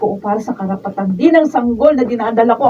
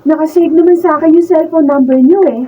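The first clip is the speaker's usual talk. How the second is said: eager and giddy; 4 semitones higher, 5 dB louder than usual. Acted. Girl eagerly thanks Raul.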